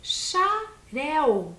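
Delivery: Xarel split into two slow syllables, XA-REL. Shown as a demonstration. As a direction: say Xarel in two separate beats. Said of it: "um peixe com duas sílabas". (Xarel separado em duas sílabas)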